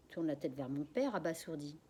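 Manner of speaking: ordinary delivery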